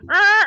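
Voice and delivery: loud, high mock squawk; imitated chicken alarm squawk. Chickens warning about squirrels, imitated squawk. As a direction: squawk like a hen raising the alarm. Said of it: "I hear the chickens going".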